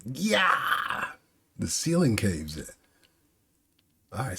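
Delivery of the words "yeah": high acted yell of shock; shocked cry. Orochi shocked as the ceiling caves in, high yell. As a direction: yelp as it all falls. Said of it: "he looks shocked".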